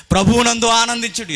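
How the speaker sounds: loud, high scripture call; ringing proclamation. Real, not acted. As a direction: proclaim it with a jump in pitch. Verse proclaimed on repeat.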